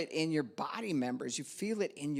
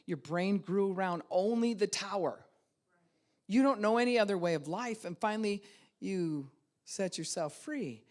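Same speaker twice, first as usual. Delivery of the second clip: ordinary talk, nothing marked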